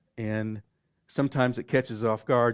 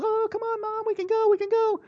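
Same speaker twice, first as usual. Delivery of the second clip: put-on pleading girls. Teenage daughters pleading with mom.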